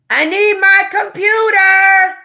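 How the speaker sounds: high, loud call across the house; yelling for the computer from the other room; real yell. Real, not acted. Mother yelling to her kids in the house, loud and high. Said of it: "I'm so sorry I'm yelling at y'all".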